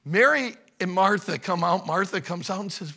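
ordinary delivery

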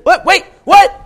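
loud, high-pitched double take; alarmed disbelief. Acted. Samson reacting to being bound, alarmed disbelief. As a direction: burst out in mock shock. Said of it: "He should have said".

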